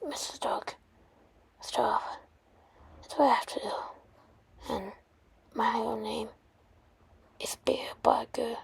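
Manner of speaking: very soft, halting near-whisper; dying hero's hush. Acted. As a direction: confess faintly with long pauses. Dying hero reveals his name.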